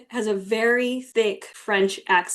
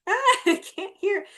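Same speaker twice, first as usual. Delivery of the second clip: much higher exaggerated whine; mock complaint; 11 semitones higher than usual. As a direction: squeak it like you can't hear. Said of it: "it's like".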